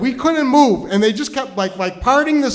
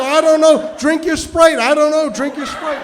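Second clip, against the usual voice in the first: flustered urging; 6 semitones higher, 4 dB louder than usual. Acted. Flustered date amid kitchen chaos.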